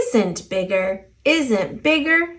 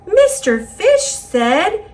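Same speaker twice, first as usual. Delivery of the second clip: plain reading voice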